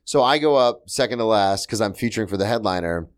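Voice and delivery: ordinary talk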